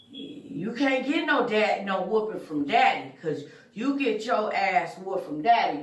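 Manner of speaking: normal talking voice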